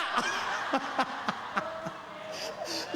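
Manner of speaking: breathy silent laugh at his own joke; wheezing chuckle after the punchline. Real, not acted. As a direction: let a voiceless laugh shake out.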